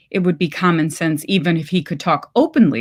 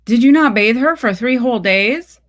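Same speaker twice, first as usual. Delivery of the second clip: accusing; 5 semitones higher, 5 dB louder than usual. Acted. Husband accuses mother on phone.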